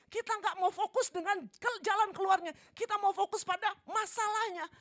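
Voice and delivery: loud, high preaching with soaring word ends; full-force preaching. Acted. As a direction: preach it out, building each line. (berkhotbah dengan berapi-api)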